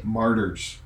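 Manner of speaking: ordinary talk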